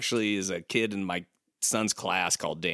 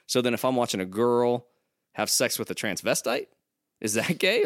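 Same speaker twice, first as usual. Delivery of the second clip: normal talking voice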